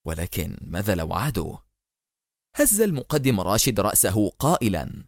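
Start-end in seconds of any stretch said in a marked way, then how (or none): 0.1-1.6 low, soft thinking aloud; murmuring to himself. A narrator's reading. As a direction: ask it under the breath, as if alone. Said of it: "يغمغم وكأنه يحدث نفسه".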